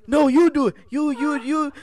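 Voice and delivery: high, loud mock impression of the other driver; imitated broken English. Mimicking the other driver's accusing reply, in a high loud put-on voice. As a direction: squeak it out in a put-on foreign voice. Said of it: "Go ahead with your impression".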